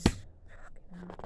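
slow hushed curse; whispered swear. Real, not acted. Fan whispering a swear at the photos. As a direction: breathe the swear out.

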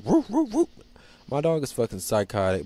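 normal talking voice

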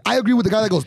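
ordinary talk

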